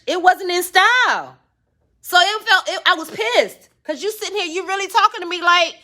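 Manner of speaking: ordinary talk, nothing marked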